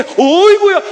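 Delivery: high, loud cry of delight; father overjoyed seeing his son return, high and loud; joyful exclamation. Acted. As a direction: call out overjoyed at the sight of him. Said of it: "하면서 막".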